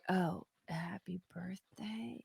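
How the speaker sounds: slow, hushed unsure whisper; questioning whisper. Retold or imitated. Son testing the mood with a slow questioning whisper. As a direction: breathe it out like a careful question. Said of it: "Like, a question".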